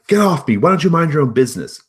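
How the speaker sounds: loud, high furious snap; angry yell. Acted. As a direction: shove her off with your voice. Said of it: "yells at her".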